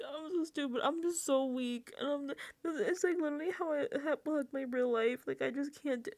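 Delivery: high, pitiful self-loathing whine; mock crying. Imitating Vince crying on gym floor, mock pitiful.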